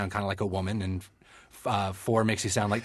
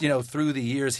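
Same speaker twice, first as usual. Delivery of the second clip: ordinary talk, nothing marked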